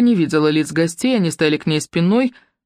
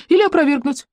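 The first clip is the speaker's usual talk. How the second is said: higher, easy agreeing tone; agreeing young man; 11 semitones higher than usual. A narrator's reading. Юнец agrees with the husband, light higher voice. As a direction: concede it lightly. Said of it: "согласился юнец".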